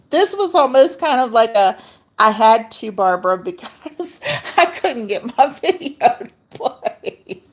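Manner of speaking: real embarrassed laughter; laughing. Real, not acted. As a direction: giggle at your own mishap. Laughing at her video trouble.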